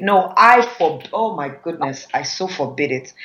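ordinary delivery

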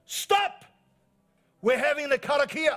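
high, very loud shout over a noisy room; big commanding holler; retold yell. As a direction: yell it out to silence the whole room. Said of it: "yelled out".

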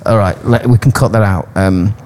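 ordinary talk, nothing marked

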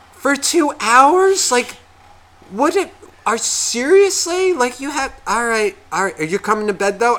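very high, slow exasperated disbelief; incredulous nagging. Retold or imitated. Ex scolds about late bedtime.

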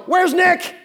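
loud high sing-song call across the house. Retold or imitated. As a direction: yell it like a parent hunting for a toddler. Parents calling for missing toddler.

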